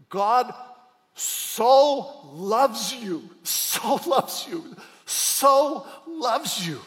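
SO stretched long, loud and high; slow loud building proclamation, "so" held; drawn-out emphasis. Acted. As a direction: lean hard and slow on "so loves you". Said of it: "It says".

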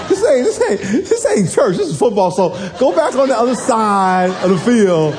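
high, taunting trash talk to the rival mom; jeering high voice telling her to leave; mocking taunt. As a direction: shoo her off with a high-pitched jeer.

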